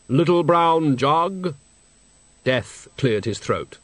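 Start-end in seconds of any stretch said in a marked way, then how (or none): 0.1-1.5 slow, hollow, solemn deathly voice; Death's voice. Acted. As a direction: speak as grave, patient Death. Death speaks.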